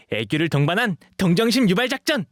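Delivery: high, loud, rising announcement; proud proclamation. Acted. Dog boy declaring his cute sympathy scheme to himself, triumphant. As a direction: proclaim the master plan with glee.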